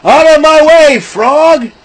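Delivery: the horse shoving past the frog, high loud mock voice; horse's voice. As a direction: barge past like a rude horse. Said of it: "the horse came in the end and goes".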